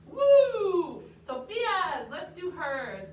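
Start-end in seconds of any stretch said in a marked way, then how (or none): 0.1-1.0 loud high whoop; excited cheer. Real, not acted. Teacher cheering her class after a rhythm.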